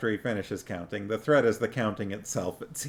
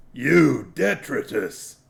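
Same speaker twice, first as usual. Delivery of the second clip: troll voice; 3 semitones higher, 6 dB louder, slower than usual. A narrator's reading. Wide troll taunting.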